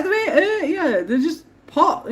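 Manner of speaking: defending the gnomes to her partner, mock pleading. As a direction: plead with him, laughing at him. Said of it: "I'm like".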